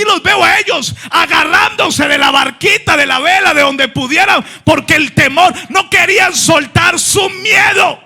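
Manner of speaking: full-force fervent preaching. Acted. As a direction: cry it out with fire.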